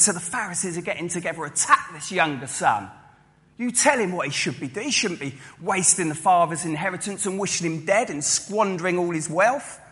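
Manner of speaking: ordinary talk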